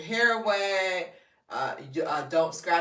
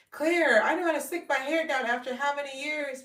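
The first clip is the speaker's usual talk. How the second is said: imitating a gushing fan, high-pitched; high, eager mock testimonial; excited fan; 10 semitones higher than usual. Viewer sharing a testimonial with her, excited.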